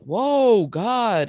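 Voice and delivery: mock startled offense, high and loud; exaggerated affronted reaction, words stretched; taken-aback men. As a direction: imitate someone recoiling from a telling-off. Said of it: "And then they're like".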